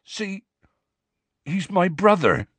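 halting, high tearful admission; tearful confession. A narrator's reading. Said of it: "tears now trickling down his bruised face".

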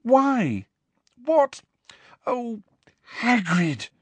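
high, halting distressed plea; despairing, pitched high; close to crying. A narrator's reading. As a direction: break it up into tearful little bursts. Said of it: "sounded as though she wanted to cry".

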